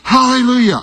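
shouted hallelujah. Retold or imitated. Pastor reliving his answered prayer, loud high shout. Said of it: "I'm shouting now".

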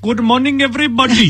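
put-on high, bright Indian-accented preacher greeting. Preacher greeting an Indian church in an Indian accent. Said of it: "preach in an Indian accent".

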